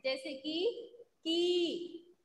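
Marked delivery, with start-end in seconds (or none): 1.3-2.2 stretched long ee vowel in की; lengthened ee sound. Shown as a demonstration. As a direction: draw out की slowly with a long ee. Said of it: "लंबा होता है ना".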